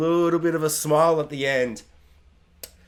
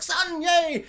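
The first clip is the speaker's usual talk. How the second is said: much higher mock cheer; hammed-up fan whoop; mock crowd cheer; 12 semitones higher than usual. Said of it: "Everyone's like".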